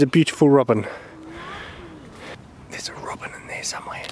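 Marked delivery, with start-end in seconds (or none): 2.7-4.0 hushed whisper; very soft, voiceless aside. Real, not acted. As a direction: whisper it so the bird isn't disturbed. Hushed remark near a robin.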